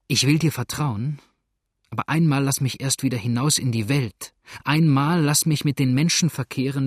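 loud, impassioned demand; urgent plea. A narrator's reading. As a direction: plead insistently, pushing the voice up. Woman springs up, pleads urgently.

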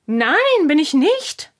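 high, indignant retort; defensive denial. Acted. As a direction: deny it hotly, pitch jumping up. Heated denial in a quarrel.

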